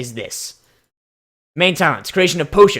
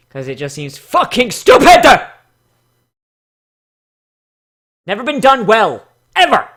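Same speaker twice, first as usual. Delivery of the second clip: louder, rising to a high yell; frustrated rant; 4 semitones higher, 7 dB louder than usual. Real, not acted. Reviewer ranting at vampire ponies, loud exasperated yell. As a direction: blow up about vampires never being done right.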